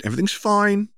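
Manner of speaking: too-bright little sister; chirpy cover-up. Acted. Sister covers for the quarrel, forced cheerful. Ginny denying in unison.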